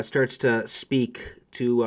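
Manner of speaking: usual speaking voice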